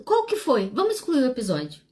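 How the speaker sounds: mock the wife's urgent pleading; lightly voiced insistent wife; wife insisting. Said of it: "Ela falou".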